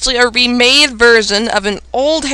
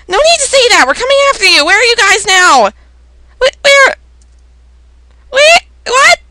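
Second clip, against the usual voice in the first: high, raised-voice alarm; urgent shouting; 9 semitones higher, 5 dB louder than usual. Acted. Friend promising rescue and asking where they are, shouting urgently. Acted shouting during the attack.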